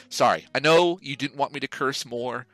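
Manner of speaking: normal talking voice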